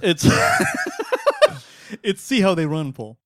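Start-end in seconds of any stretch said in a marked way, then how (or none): 0.3-1.6 high shouted laugh; laughing at own choice; loud laugh. Real, not acted. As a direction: let out a big yelping laugh.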